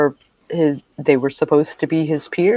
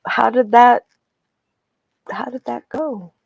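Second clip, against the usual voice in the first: raised-pitch voice for Professor Edge; higher character voice; 10 semitones higher, 3 dB louder than usual. Acted. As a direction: lift the voice up high for the professor. GM voices Edge asking about trial.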